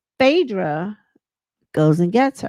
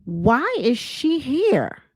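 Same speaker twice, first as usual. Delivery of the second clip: indignant question; 4 semitones higher, much slower than usual. Retold or imitated. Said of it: "Toya finally pipes up and says".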